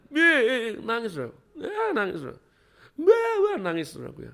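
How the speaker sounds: high, laughing mock wail; mock crying. Preacher mocking crybaby children, high laughing mock wail. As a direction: tease a child who cries too long.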